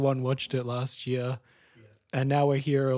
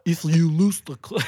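higher, louder mock Russian accent; 3 semitones higher, 5 dB louder than usual. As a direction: imitate a bad Russian accent. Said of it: "just in a Russian accent".

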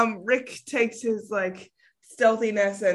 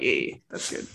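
much lower, softer conspiratorial voice; sly knowing voice; 6 dB quieter than usual. Acted. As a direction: say it low like a sly wink. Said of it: "Rick's tapping his nose like".

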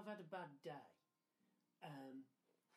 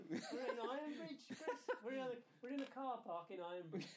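laughing; 6 semitones higher, 8 dB louder than usual. Real, not acted.

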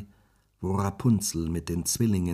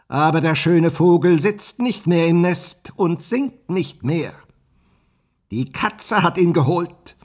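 scornful witch; 7 semitones higher, 8 dB louder than usual. Acted. The witch taunts the prince that Rapunzel is lost, loud and mocking. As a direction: relish crushing his hopes. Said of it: "rief sie höhnisch".

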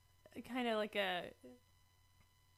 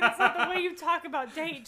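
exasperated; 4 semitones higher, 9 dB louder than usual. Retold or imitated. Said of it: "And I was like".

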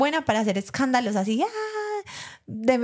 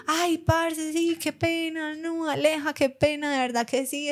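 embarrassed apology; 7 semitones higher, slower than usual. Retold or imitated. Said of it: "él como que".

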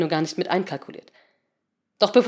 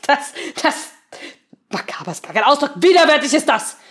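angry; 6 semitones higher, 6 dB louder than usual. Acted. Seemann ranting in disgust.

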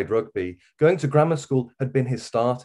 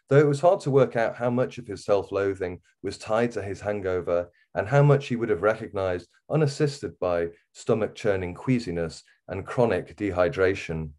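usual speaking voice

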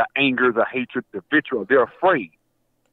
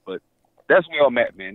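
ordinary talk, nothing marked

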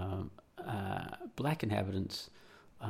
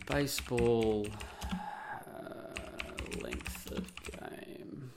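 muttering while typing; 2 semitones higher, much slower than usual. Real, not acted. Typing a Google search aloud.

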